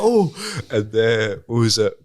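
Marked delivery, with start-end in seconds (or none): 0.0-0.3 high, loud mock gasp of shock. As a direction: yelp it like seeing the punch land. Retold dad reacting again.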